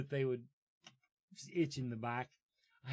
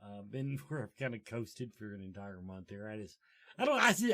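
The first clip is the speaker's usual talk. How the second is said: ordinary talk, nothing marked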